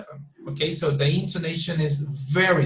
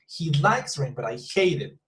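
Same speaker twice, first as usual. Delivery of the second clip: LIKES and HATE each leaned on and falling; emphasize both verbs, LIKES and HATE; stress on LIKES and HATE. Shown as a demonstration. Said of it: "you need to emphasize on those two".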